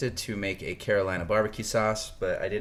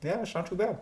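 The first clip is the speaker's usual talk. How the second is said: mouth full; 8 semitones higher, 10 dB louder than usual. Real, not acted. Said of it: "why not talk with your mouth full".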